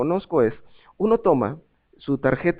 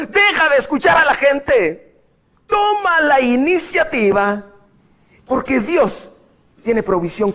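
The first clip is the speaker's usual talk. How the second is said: fiery preaching; 6 semitones higher than usual. Acted. Pastor urging the congregation to persist, fiery preaching. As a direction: preach it hard and stubborn, building.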